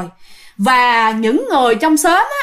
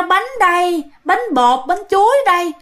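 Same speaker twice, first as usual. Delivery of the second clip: higher, lightly imitated hawker's call; retold vendor's cry; 5 semitones higher than usual. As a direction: echo the cake seller's call in a boy's retelling. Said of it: "xong cái bà rao". (nhại nhẹ tiếng rao bánh)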